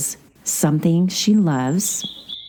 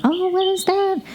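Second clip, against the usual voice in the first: much higher, lightly curious question. Retold or imitated. Dakota spotting the hourglass, high curious question. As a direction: pop it up high like a curious girl.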